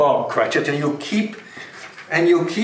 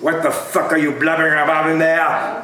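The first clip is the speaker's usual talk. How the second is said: normal talking voice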